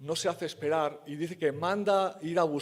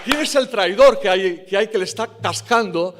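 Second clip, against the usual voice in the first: furious shout, much louder and higher; roaring king; 6 semitones higher, 13 dB louder than usual. Retold or imitated. Enemy king roars at his men, furious shout. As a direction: bellow it like an enraged king hunting a traitor.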